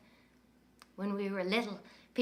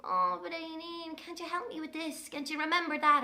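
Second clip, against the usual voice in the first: mopey higher whine; whiny sad brother; 7 semitones higher, 3 dB quieter than usual. Retold or imitated. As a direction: mimic a mopey little brother. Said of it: "sad, upside-down face saying".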